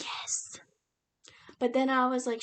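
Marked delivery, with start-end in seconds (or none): 0.0-0.6 whispered yes. Retold or imitated. Whispered cheer while reading.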